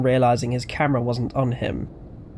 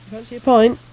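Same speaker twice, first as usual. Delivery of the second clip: put-on high, eerie Essex accent; a ghostly voice with Essex vowels, raised; 10 semitones higher, 6 dB louder, faster than usual. A narrator's reading. Ghost voice on the phone offering a pint, Essex accent. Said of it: "The accent was an Essex one".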